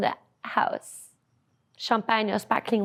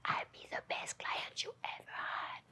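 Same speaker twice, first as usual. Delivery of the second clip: low, intimate whisper; a soft, seductive whispered pledge; whispered promise; 21 dB quieter than usual. Retold or imitated. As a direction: murmur it close and seductive. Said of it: "And he's like".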